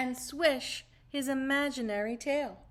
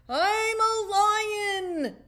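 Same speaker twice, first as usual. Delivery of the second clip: much higher, louder, slow and grand; proudly; 10 semitones higher, 7 dB louder, much slower than usual. Acted. Caterpillar boasting to the tired tortoise, proud and slow. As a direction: puff up and announce it with pride. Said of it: "he said proudly".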